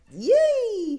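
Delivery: high, squeaky mock moan; silly mock moan of pleasure; mock sexy noise. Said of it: "mine are like".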